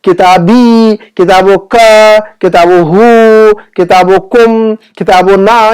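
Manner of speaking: "किताबो का" with a long, held "का"; drawn-out endings. Shown as a demonstration. Teacher showing students the attached pronouns, endings held long. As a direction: stretch the last syllable of "किताबी", "किताबो का", "किताबो ना".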